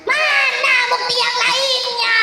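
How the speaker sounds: put-on high, slow, defiant old-witch voice; scornful hag demanding proof; doubting witch. A narrator's reading. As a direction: challenge the giant, rising to a shout. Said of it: "tetap saja tidak percaya".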